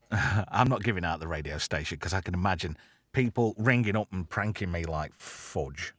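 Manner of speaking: ordinary talk, nothing marked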